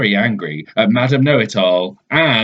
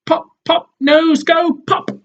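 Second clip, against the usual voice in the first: much higher babbling; baby. Acted. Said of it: "says the baby".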